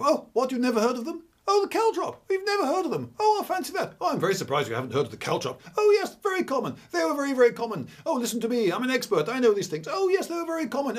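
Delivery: high, mocking know-it-all voice; pompous expert. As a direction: sneer it like a smug expert showing off. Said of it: "said something along the lines of".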